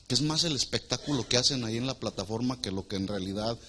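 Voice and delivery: usual speaking voice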